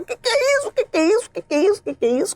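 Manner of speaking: high repeated cry, stepping down in pitch; imitated alarm, repeated over and over; retold panicked outcry. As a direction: mimic a classmate shrieking in shock.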